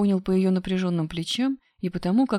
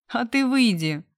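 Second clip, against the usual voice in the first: higher, louder taunt; mocking; 6 semitones higher, 5 dB louder than usual. A narrator's reading. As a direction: dismiss him with a teasing jab. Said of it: "насмешливо сказала Машка".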